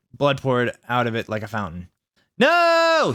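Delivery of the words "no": very high, held-out scream; hammed-up death cry. A narrator's reading. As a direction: scream it long and melodramatically. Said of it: "he screamed".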